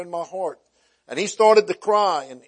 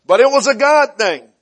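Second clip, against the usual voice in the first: loud ringing preacher's cry, stressing God; belted out from the pulpit; preaching shout; 7 semitones higher, 10 dB louder than usual. Real, not acted. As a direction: proclaim it to the whole church. Preacher proclaiming climax.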